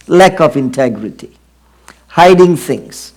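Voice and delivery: usual speaking voice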